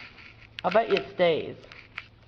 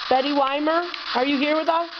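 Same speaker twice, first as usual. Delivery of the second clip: high, raised voice calling to spirits; EVP spirit call-out; 9 semitones higher than usual. Acted. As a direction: call out gently into the dark room.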